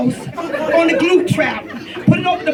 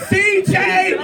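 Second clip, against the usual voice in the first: higher-pitched mock yell; high, stylized calling out; retold scream; 5 semitones higher than usual. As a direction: imitate a mother's scream, without real volume. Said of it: "I'm screaming".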